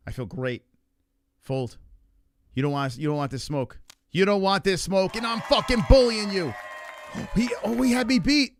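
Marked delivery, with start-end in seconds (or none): 4.1-6.5 building louder and higher trash talk; hyped taunting. Real, not acted. Player bullying poker opponent, rising loud trash talk. 6.6-7.3 sharp breathless gasp; shocked gasp. Real, not acted. Player seeing the winning hand, shocked gasp. 7.3-8.5 high, louder shocked realisation; stunned disbelief. Real, not acted. Player realising opponent won, high stunned cry.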